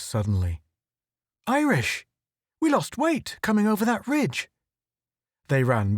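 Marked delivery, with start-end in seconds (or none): 1.5-4.5 high, urgent cry to his partner; alarmed call. Acted. Photographer alerting Irish, high alarmed call. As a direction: shout it out in alarm. Click alarmed at lost weight.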